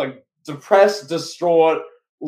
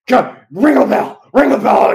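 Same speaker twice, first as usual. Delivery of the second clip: higher, louder imitation of a frantic boss; urgent hammed-up shout; mock shouted order; 6 semitones higher, 6 dB louder than usual. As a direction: yell the command to ringside. Imitates Vince ordering the bell.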